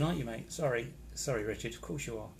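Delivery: normal talking voice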